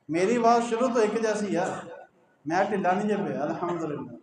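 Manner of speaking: normal talking voice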